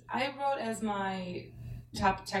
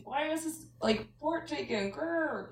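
frustrated; 8 semitones higher than usual. Retold or imitated. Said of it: "So I'm just like".

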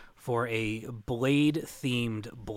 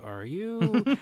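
mock questioning look; 10 semitones higher than usual. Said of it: "I gave him one of those".

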